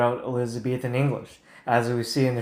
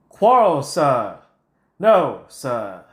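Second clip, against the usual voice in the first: mock British accent; 6 dB louder, much slower than usual. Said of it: "uh, British accent".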